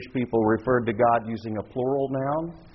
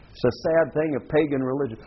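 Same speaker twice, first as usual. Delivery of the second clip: ordinary talk, nothing marked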